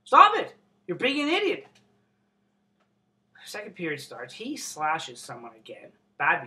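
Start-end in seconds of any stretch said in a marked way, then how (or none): 0.0-1.7 a loud, high scolding in a ref's voice; mock ref warning. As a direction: bark a warning like a fed-up referee. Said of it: "the refs are warning him".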